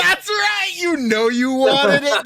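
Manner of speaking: shouted quote. Retold or imitated. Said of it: "That's the quote".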